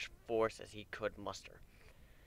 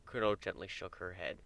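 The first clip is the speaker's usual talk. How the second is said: ordinary delivery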